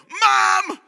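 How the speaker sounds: loud, high mock cry for Mom; hammed-up shout to mother; call for help. Shown as a demonstration. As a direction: yell like a tattling child. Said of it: "we're gonna call for help".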